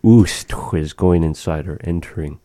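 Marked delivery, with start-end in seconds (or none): 0.0-0.5 "ust" with a loud, higher "oo"; strongly stressed "oo" in "ust". Shown as a demonstration. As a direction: punch the "oo" vowel that opens "ust". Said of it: "u" on those kinds of words".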